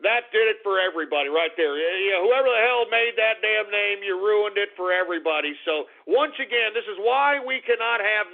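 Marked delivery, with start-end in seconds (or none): none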